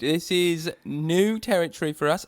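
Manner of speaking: ordinary talk, nothing marked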